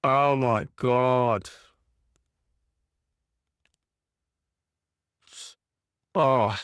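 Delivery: low, slow groaning of a hurt fan; real dejection. Real, not acted. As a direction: let the hurt sink the voice down low. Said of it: "Oh, this hurts".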